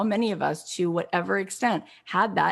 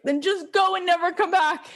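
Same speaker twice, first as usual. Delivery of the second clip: high, forceful mock dismissal; retold angry outburst; 10 semitones higher, 4 dB louder than usual. Mother throwing daughter out, retold angry outburst. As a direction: throw her out in one raised burst. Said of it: "And she got so angry".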